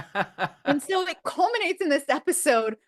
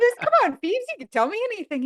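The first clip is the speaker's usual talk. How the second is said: high, louder and fast coaxing; the speaker urging Phoebe, high and fast; eager pleading; 8 semitones higher, 3 dB louder, faster than usual. Retold or imitated. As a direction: beg Phoebe to spill it. Her own pleading voiced high.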